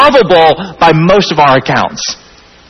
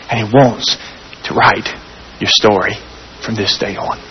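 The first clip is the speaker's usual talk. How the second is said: lower, softer, fading to near hush; quiet tender appeal; 6 semitones lower, 3 dB quieter than usual. Acted. Preacher inviting outsiders to accept Jesus, soft and tender. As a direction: lay it gently on their hearts.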